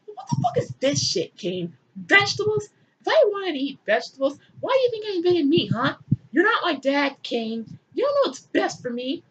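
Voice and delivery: high, indignant ranting deity; hammed-up high outrage; mock God scolding.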